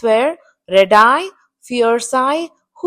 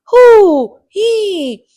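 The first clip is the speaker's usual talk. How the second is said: owl war cry; 7 semitones higher, 6 dB louder than usual. A narrator's reading. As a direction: hoot out a drawn-out war cry. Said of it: "with blood-curdling war cry".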